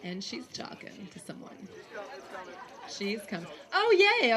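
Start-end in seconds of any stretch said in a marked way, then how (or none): 3.7-4.4 excited. Real, not acted. Portrait sample arrives.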